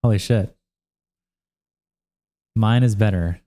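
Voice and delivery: ordinary talk, nothing marked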